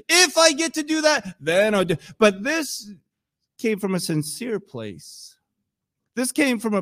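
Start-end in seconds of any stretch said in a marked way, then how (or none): 0.1-2.0 high-pitched conditional, held up; bargaining if. Acted. Believer bargaining with God, mock hopeful high pitch. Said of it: "And if then".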